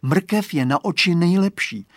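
a know-it-all little gardener; confident boy. Acted. Boy's voice.

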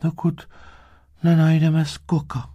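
very high little-creature voice; Kuk. A narrator's reading. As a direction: answer in a small, high voice. Little Kuk answers Medák.

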